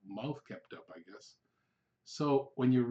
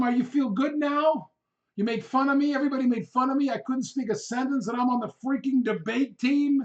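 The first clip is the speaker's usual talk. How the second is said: much higher, loud furious rant; retold yelling; 11 semitones higher, 8 dB louder than usual. Humiliated student yelling at his teacher on the drive home. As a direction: rant at him, high and loud, slowing down. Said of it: "after I stopped yelling".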